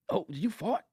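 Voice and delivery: normal talking voice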